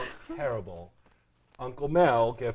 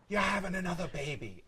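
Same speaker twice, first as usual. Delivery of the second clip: higher put-on aunt voice; a hint of excited aunt; Aunt Rhoda; 6 semitones higher than usual. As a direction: lightly imitate the aunt guessing the news. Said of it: "she actually said".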